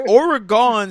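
Oregon with a drawn-out "gone" ending; Oregon like octagon. Shown as a demonstration. Cohost mocks it as a shape name, Oregon as OR-uh-gone. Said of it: "sound like you trying to name a shape".

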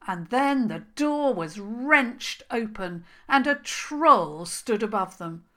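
ordinary delivery

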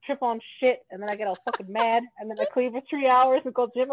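ordinary delivery

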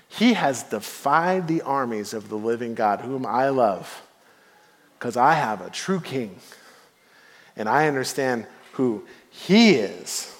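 low, soft, earnest conviction; solemn declaration. Acted. David telling the king why he will fight, hushed conviction. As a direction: bring it right down, measured and sincere.

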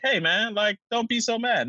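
mock Spider-Man telling a villain to calm down. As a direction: talk down to him in a squeaky voice. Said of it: "Spider-Man's like".

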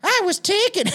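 high-pitched, exaggerated teenage boy; jokey high boy voice; sixteen-year-old kid. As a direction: put on a squeaky kid voice as a joke. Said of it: "He's a fucking sixteen-year-old kid".

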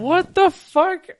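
high, loud, slow and drawn out; shocked reaction. Retold or imitated. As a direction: stretch it out in disbelief. Reacting to being asked for weed.

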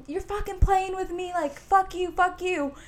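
high-pitched mock yell of the angry man; imitated screaming. Angry man screaming at the guys, high mock yell. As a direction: rant like the stranger throwing logs. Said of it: "And he's screaming".

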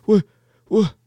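high, dazed what happened; bewildered guy coming to; confused. Retold or imitated. Confused guy after unmasking.

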